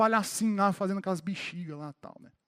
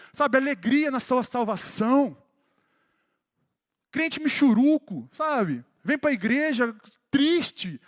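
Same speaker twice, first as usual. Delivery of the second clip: raised, impassioned pulpit voice, pushing hard; rally the church like a revival preacher; fervent preaching; 4 semitones higher than usual. Acted. Pastor urging the congregation to have joy, fervent raised preaching.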